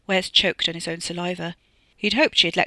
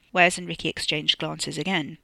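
usual speaking voice

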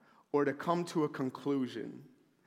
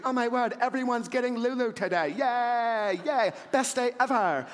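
mock excited girls; 6 semitones higher than usual. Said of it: "they must be thinking".

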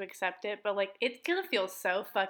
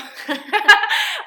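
high, loud burst of laughter; 11 semitones higher, 13 dB louder than usual. Real, not acted. Podcaster amused by her own story intro, laughing loudly. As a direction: crack up loudly at your own joke.

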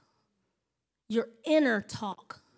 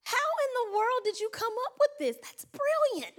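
gasp, then a high, fast, astonished praise; high amazed admiration; 10 semitones higher than usual. Retold or imitated. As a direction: marvel at a brilliant answer. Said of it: "But then I could say".

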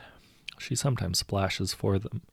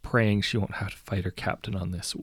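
plain reading voice

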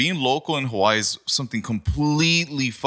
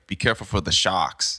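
low Jersey warning, "sharks" as SHAHKS; Jersey accent; 3 semitones lower than usual. Retold or imitated. Roommate warning swimmers in Hawaii, Jersey accent. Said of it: "my old roommate from Jersey".

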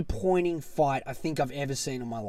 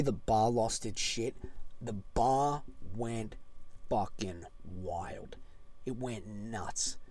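low, soft, very slow; hushed emphasis; 7 semitones lower, 11 dB quieter, slower than usual. Real, not acted. Fan telling how the bar went wild, hushed. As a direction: spell it out slowly for dramatic weight. Dramatic understated build-up in storytelling.

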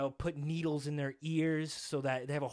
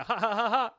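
loud, higher rapid ha's; forced laughing on the verge of real; imitated forced laugh; 6 semitones higher, 6 dB louder than usual. Retelling how buddies keep it up, imitated forced laugh. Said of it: "until they actually start laughing".

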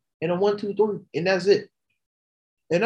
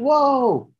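high, thrilled gasp at the name; excited fan; 8 semitones higher than usual. Retold or imitated. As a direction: squeal it like a fan seeing Becky arrive. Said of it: "You're like".

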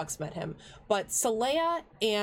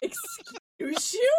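very high, slow, drawn-out squeal of offence; a hammed-up scandalised squeak; mock offended; much slower than usual. Mock-offended echo of the line.

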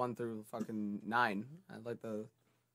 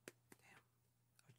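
whispered; 34 dB quieter, much slower than usual. Real, not acted. Forgetting a show's name.

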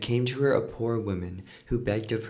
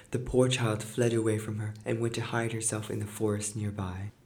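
ordinary delivery

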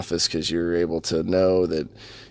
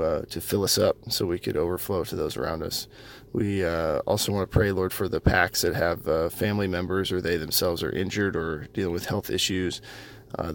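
prayer; 6 dB quieter than usual. Acted. Closing prayer.

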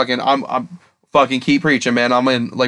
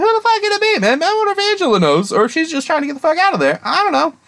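frantic puzzling. Retold or imitated. Acted inner monologue while watching.

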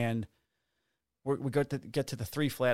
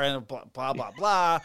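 ordinary delivery

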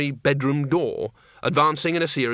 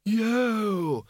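put-on high, drawn-out, thin old-wizard cheer; Windle's cheer; 9 semitones higher than usual. A narrator's reading. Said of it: "said Windlepoons".

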